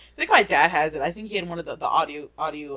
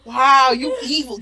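mock shocked; 7 semitones higher, 8 dB louder, slower than usual. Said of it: "It's like".

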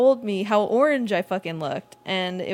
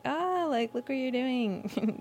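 mock teasing; 6 semitones higher, 4 dB quieter, slower than usual. Teasing friends mid-hookup.

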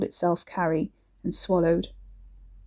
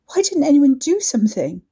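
accusing cry; 7 semitones higher, 5 dB louder than usual. A narrator's reading. Demands answer, raw throat.

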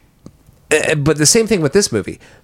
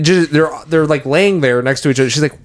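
ordinary delivery

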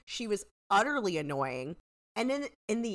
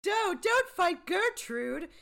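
high, slow, hammed-up protest; mock pleading; 12 semitones higher, 4 dB louder, much slower than usual. Acted pleading of the group.

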